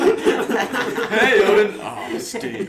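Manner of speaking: high, loud, laughing god voice; hammed-up booming Odin; Odin impression. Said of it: "Is that your Odin impression".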